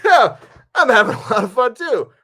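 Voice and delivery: loud, squeaky-high hammed-up delight; over-eager agreement. Nervous director echoes the actress's praise, over-eager agreement. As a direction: overdo the enthusiasm in a very high voice. Acted relieved reply to her praise.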